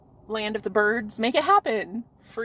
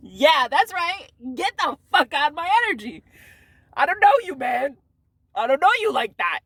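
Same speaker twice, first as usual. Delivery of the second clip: loud dismissal; 5 semitones higher, 5 dB louder than usual. Real, not acted. To people with bad energy, a loud high dismissal. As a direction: shove them out with your voice.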